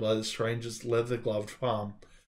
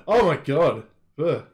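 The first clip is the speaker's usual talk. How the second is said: loud, high gasp of shock; real shock at the picture; shocked exclamation; 4 semitones higher, 8 dB louder than usual. Real, not acted. Reader seeing the ostrich, loud shock.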